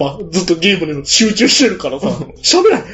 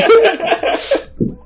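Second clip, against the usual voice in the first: high burst of laughter. Real, not acted.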